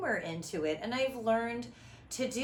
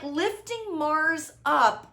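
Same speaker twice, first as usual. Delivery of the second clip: ordinary talk, nothing marked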